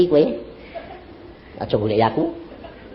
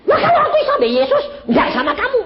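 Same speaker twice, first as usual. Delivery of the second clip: mock woman retort; much faster than usual.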